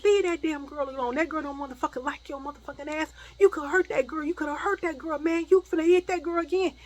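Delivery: high, loud, heated rebuke; angry defending. Retold or imitated. Acted cousin defending her.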